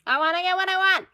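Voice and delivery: loud, fast, whiny demand; bratty. Acted. Woman admitting a childish wish, bratty and loud. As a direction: stomp it out like a spoiled kid. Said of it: "It sounds bratty".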